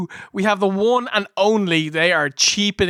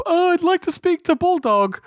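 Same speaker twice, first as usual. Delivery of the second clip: mock caller; 10 semitones higher than usual. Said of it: "someone calls up".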